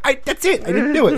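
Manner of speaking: high, loud protesting yelp; mock-frantic, pitch way up; panicked denial. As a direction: act out a kid desperately pleading innocence. Retold panicked denial to dad.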